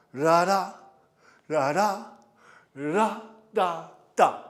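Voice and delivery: "ra ra" rising to a punched second "ra"; chanted "ra ra" step rhythm with the accent pushed; accented dance syllables. Shown as a demonstration. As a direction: voice the step's rhythm, lifting the second "ra". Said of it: "where is the accent?".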